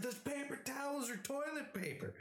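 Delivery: high exasperated shout; exasperated yell. Retold or imitated. Arguing with roommate, high exasperated yell. Yelling argument.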